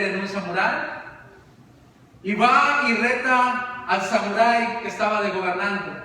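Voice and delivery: ordinary talk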